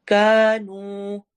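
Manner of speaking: very slow, both long vowels stretched; Quran recitation. Shown as a demonstration. Teacher showing long vowels to students, slow. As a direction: draw out each vowel. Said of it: "अलिफ मद्दा और वाव मद्दा है".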